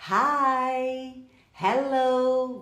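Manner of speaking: held-out "hi", "hello", each with aspirated H; H pronounced. Shown as a demonstration. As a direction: call out "hi, hello" with the H clearly sounded. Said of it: "o H é pronunciado".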